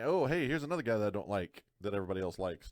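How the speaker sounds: ordinary delivery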